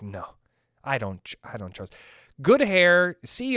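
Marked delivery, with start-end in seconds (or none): 0.0-2.0 much softer, lower distrustful murmur; wary mutter. Retold or imitated. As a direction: pull back, mumble suspiciously. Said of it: "you're like".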